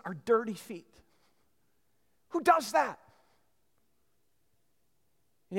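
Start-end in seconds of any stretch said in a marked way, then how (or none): 2.3-3.0 high-pitched, incredulous question; amazed exclamation. Real, not acted. Preacher amazed at the kissing of dirty feet, incredulous exclamation. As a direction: burst out in astonishment.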